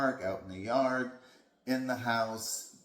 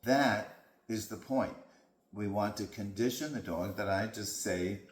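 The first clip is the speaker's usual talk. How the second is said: normal talking voice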